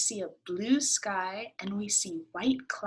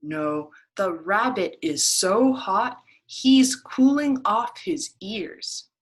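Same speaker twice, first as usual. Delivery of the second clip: louder, slow rabbit voice; 10 dB louder, slower than usual. Acted. Rabbit insisting to the duck, louder and slow. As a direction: explain it grandly as the rabbit.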